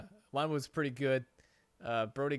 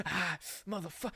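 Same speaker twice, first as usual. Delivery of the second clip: imitating angry cursing; 4 semitones higher, 3 dB quieter than usual. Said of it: "she was pissed".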